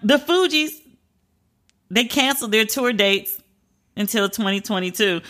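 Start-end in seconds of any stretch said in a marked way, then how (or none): none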